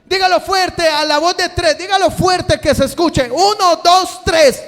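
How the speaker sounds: loud, high, driving sermon shouting; preaching at full force. Acted. Preacher calling the congregation to answer God, shouting. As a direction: preach it hard to the whole congregation.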